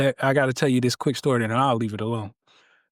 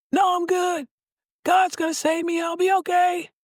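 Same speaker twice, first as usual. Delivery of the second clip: much higher, cheerful call back; stubborn stranded man. Acted. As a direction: shout back that God has it covered. Joke: stranded man refuses third time.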